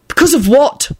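indignant interruption; confrontational. A narrator's reading. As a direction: cut in and challenge him. Neville interrupts, glowering confrontation follows.

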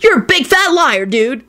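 high-pitched whiny comeback; whining. A narrator's reading. Kenny accusing Parks, whining. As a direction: whine the counter-accusation. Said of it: "Kenny whined".

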